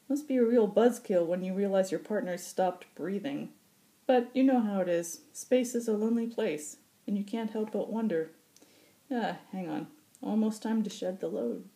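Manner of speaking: high, gruff musing, then businesslike; musing old pilot. Acted. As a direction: muse on loneliness, then snap to work. Occam voiced, musing then brisk.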